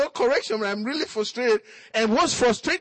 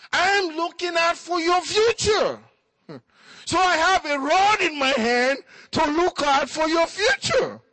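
raised, ringing sermon voice, building to shouts; preaching; 6 semitones higher than usual. Acted. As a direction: preach it full force to the congregation. Preacher exhorting parents.

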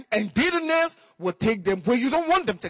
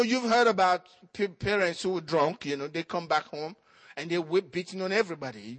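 ordinary talk

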